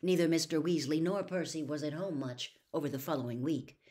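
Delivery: plain reading voice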